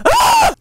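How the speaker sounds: piercing high loud scream; real scream at being killed in the game; death scream. Real, not acted. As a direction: shriek as you get killed. Killed by the murderer.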